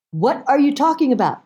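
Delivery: high-pitched disbelieving question; incredulous daughter. Retold or imitated. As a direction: question mom as if baffled. Daughter reacting to mother's confession.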